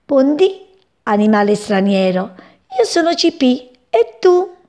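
small bird shouting a greeting up high; little bird calling out. Acted. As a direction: call out brightly to the stranger. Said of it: "e gridò".

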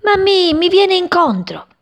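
high, loud alarmed call; excited chick. Acted. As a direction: cry out as it rushes closer. Chick calls while diving. (grido acuto ed eccitato)

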